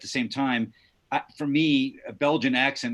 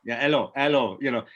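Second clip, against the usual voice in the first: put-on Belgian accent; 3 semitones higher, 4 dB louder than usual. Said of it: "anybody wanna give us a little Belgian?".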